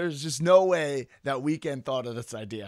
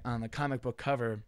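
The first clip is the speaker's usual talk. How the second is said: ordinary delivery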